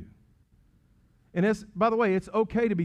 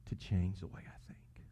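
soft, slow whisper of a humble prayer; preacher modeling a humble prayer, whispered; 7 semitones lower, 8 dB quieter, slower than usual. Pretend. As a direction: pray it barely aloud. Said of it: "honor this prayer".

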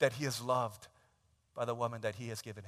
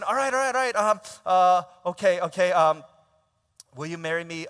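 flustered groom retrying; 5 semitones higher, 12 dB louder than usual. Retold or imitated. Groom calming, asks again.

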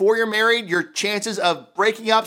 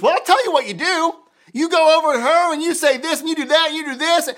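much higher, louder, hammed-up fixer husband; mock know-it-all husband; 9 semitones higher, 5 dB louder than usual. Pastor mocks a husband lecturing his upset wife, hammed up. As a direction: play the eager fixer husband. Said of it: "your knight in shining armor".

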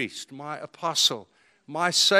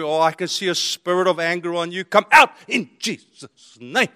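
mock deliverance prayer; 7 dB louder than usual. Mock deliverance prayer over him.

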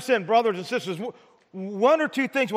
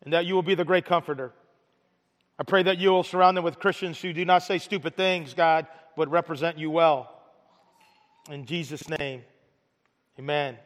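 prayer; 4 semitones lower than usual. Acted. Closing prayer.

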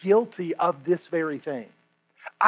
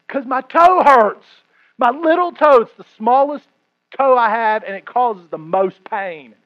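mock angry complaint; 4 semitones higher, 8 dB louder than usual. Pastor replays answering his wife about his toe, loud mock grievance. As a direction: whine it out loud like a wounded man.

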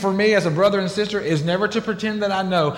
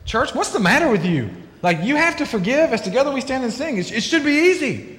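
higher, sarcastic nagging preacher; higher, flippant it should be easy; mock preachy scold; 6 semitones higher than usual. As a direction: lampoon the preacher who scolds his church. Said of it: "I am not gonna be the person this morning that says".

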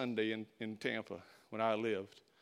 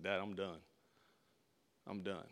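low, soft resignation; defeated quitting; 5 semitones lower, 9 dB quieter than usual. Retold or imitated. Son tells his dad he is quitting, quietly defeated. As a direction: quietly give up, voice dropped.

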